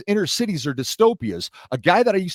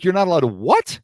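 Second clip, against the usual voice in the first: higher, louder question rising on the last word; incredulous; 4 semitones higher, 5 dB louder than usual. Retold or imitated. Customer reacting to store staff's no-touch rule, incredulous. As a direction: repeat it back in loud disbelief. Retelling disbelief at store policy.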